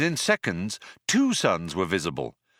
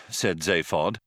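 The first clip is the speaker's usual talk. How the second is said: ordinary delivery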